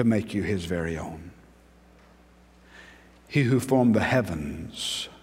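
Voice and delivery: tender pulpit hush; hushed sermon. Acted. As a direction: lean in and speak it gently. Preacher drops to intimate hush.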